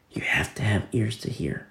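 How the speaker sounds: hushed whispered point. Acted. Preacher telling the church to listen, whispered. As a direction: let it fall to a whisper. Aside about spirit of law.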